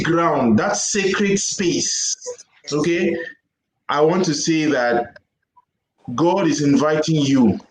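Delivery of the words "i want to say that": usual speaking voice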